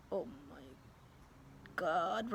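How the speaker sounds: low, soft exasperated sigh of a phrase; exasperated teacher. Retold or imitated. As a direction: imitate a teacher groaning in disbelief. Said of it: "Missus Granger's going like".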